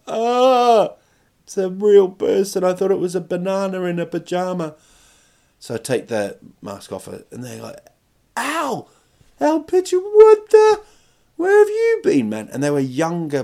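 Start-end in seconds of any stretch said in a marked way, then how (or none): 0.1-4.7 high mock-horrified wail; horrified kids. Kids seeing the banana's mask come off, mock horror. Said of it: "be horrified. They're gonna be like". 8.4-12.5 very high, squealing surprise; mock astonishment. Friends' younger siblings recognising the unmasked banana, mock astonishment.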